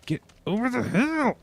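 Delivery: high, squeezed frustrated push; straining effort. Real, not acted. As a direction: force it out while heaving. Straining cart up hill.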